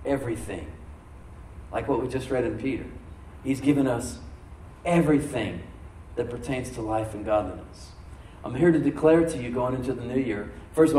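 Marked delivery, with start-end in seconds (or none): none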